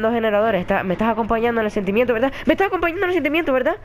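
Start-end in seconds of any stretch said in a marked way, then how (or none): 2.5-3.8 high, rushed, anxious question; nervous plea. Real, not acted. Nervous player begging Alba for support, anxious plea. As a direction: race through it, pitched up, begging for company.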